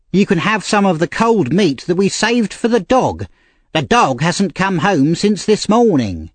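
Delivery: high-pitched scolding lady; angry. A narrator's reading. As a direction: scold the new boy as the cross mistress. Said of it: "said the lady angrily".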